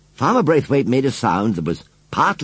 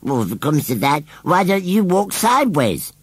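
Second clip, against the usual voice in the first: retort; 7 semitones higher than usual. A narrator's reading. Scarecrow answers the boy's complaint back, retorting. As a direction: retort rising high on the question. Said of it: "retorted Gummidge".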